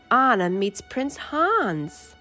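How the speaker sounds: usual speaking voice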